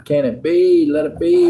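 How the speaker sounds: ordinary talk, nothing marked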